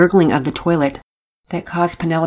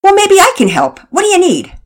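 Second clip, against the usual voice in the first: high, loud, fast laid-back helper; eager casual neighbor; 6 semitones higher, 6 dB louder than usual. A narrator's reading. New neighbor offering the kids his plunger, loud eager and casual. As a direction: jump in eagerly to help.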